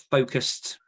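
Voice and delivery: usual speaking voice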